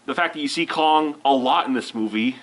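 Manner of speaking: usual speaking voice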